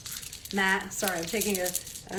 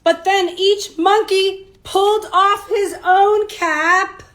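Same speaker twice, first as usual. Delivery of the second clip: slow, louder storybook build-up; slow dramatic narration; 8 semitones higher, 9 dB louder, slower than usual. A narrator's reading. Teacher reading the twist slowly to the children. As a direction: draw out the big moment.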